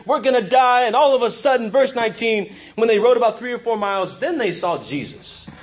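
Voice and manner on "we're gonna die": mild panicked yell. Retold or imitated. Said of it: "probably screaming and yelling at each other".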